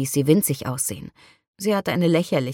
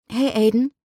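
bright, higher, cheerful hello; cheerful greeting; 6 semitones higher than usual. A narrator's reading. Shelby greets Aiden at his car, cheerfully. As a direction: greet him with a lift in the voice. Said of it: "sagte Shelby fröhlich".

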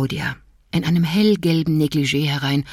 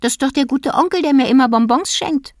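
put-on high, quick child's voice; disappointed little boy; 8 semitones higher than usual. A narrator's reading. Little boy telling parents the intruder is only Tommy, high child voice. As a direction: sound like a sleepy disappointed kid.

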